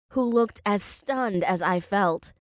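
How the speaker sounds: usual speaking voice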